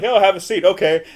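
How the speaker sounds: high, loud stiff robot greeting. Retold or imitated. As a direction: play the clunky robot host. Voicing the robot in the movie.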